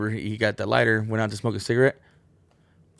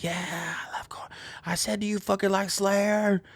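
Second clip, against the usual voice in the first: whispered then high voice; mock crowd reply; 11 semitones higher, 3 dB quieter than usual. Crowd answers and [name] repeats, mock voice. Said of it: "everyone's like".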